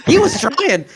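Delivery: laughing through the words. Real, not acted. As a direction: chuckle it out. Laughing while commenting.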